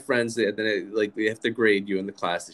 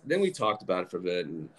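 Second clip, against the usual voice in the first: usual speaking voice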